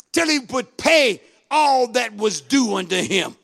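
ordinary talk, nothing marked

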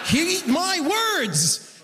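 slow, high, drawn-out warning; mock proclamation.

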